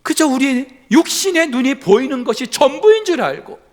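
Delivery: loud, high, building sermon climax; fervent preaching. Acted. Preacher builds to a fervent climax before the congregation. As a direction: preach it out with full force.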